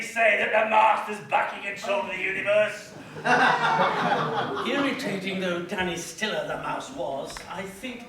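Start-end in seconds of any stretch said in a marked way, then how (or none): 3.0-4.6 a loud pleased chuckle; gloating laugh. Acted. Gloating over Danny Stiller with a loud laugh. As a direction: laugh at the rival's downfall.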